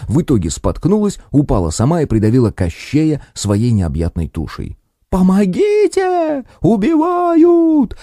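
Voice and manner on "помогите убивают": very high, very slow hysterical yell; yelling for help. Acted. The aunt screams for help after falling, hysterical yell. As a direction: howl it out as if being murdered. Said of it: "заорала тётка".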